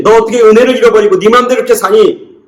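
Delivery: high-pitched, acted exasperation; angry rebuke. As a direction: berate them like a let-down father. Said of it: "라고 화를 내든지". (화내며 높은 목소리로 나무라듯)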